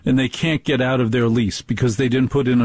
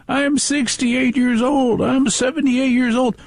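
much higher mock old-timer voice; imitating old caller; 12 semitones higher than usual. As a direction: pipe up like an elderly caller announcing his age. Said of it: "I'm sure you're gonna say".

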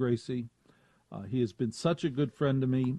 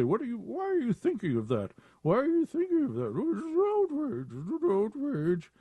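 mock outrage; 10 semitones higher than usual. Imagined outraged critic.

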